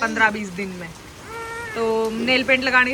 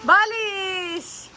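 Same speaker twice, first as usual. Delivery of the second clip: put-on high, long-drawn baby-talk squeal; 9 semitones higher, much slower than usual. Mother to child about the rain, playful baby talk.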